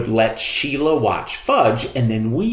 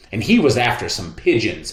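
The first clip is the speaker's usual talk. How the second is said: ordinary delivery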